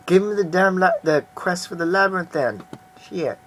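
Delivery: fed up with having to repeat the quest; real irritation, voice jumping up; exasperated outburst. Real, not acted. Player annoyed at repeated quest.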